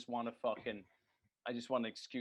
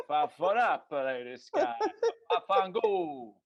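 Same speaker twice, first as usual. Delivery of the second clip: loud mock Italian-American gibberish with a laugh; hammed-up Italian mobster babble, last bit slow; vaguely Italian noises; 6 semitones higher, 8 dB louder, slower than usual. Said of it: "these vaguely Italian noises".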